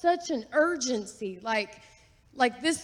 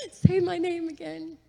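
higher, giggly plea; retold excited plea; 7 semitones higher than usual. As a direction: retell it through a delighted laugh. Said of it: "And I said it again".

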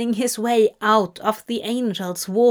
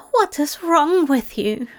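higher, upset cry, a touch of sobbing; 6 semitones higher than usual. A narrator's reading. Tearful angel to Crowley, lightly sobbing. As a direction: plead in tearful disbelief, lightly played. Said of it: "Zira sobbed".

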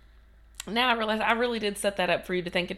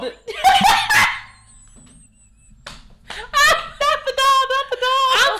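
mock horror; 14 dB louder, slower than usual.

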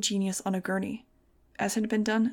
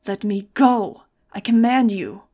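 much louder commanding yell; shout; 10 dB louder, slower than usual. Acted. Doctor held from behind by Dylan, shouting orders. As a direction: bellow the order to be freed. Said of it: "I heard Doctor Gunther Hagen shout".

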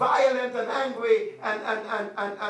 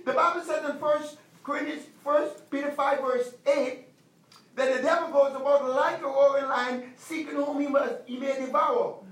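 ordinary talk, nothing marked

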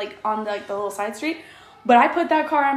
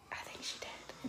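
hushed, breathy whisper, slow; whispering to a coworker about a kid's bad word; 24 dB quieter, slower than usual. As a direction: whisper it like a shocked secret. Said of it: "she's like".

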